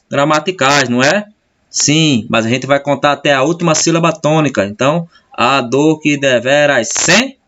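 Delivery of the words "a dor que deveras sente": "sente" rising strongly on "sen"; stress on SEN. Shown as a demonstration. Teacher showing the last tonic syllable, stressing "sen" in "sente". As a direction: count out "a dor que deveras sente" up to a lifted "sen". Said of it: "é o mais forte aqui".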